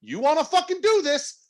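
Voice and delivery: high-pitched imitation of a manager squaring up; retold confrontation. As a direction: act out calling someone out. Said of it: "to talk to him".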